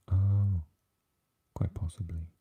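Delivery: ordinary talk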